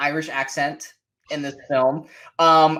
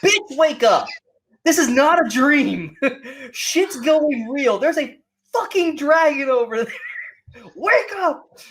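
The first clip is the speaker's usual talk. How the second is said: higher, impatient scolding with laughs; mock exasperated snap; 6 semitones higher than usual. Imagining Depp scolding Alice, laughing, mock exasperation. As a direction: shake her awake with exasperation. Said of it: "I was waiting for Johnny Depp to just slap her and say".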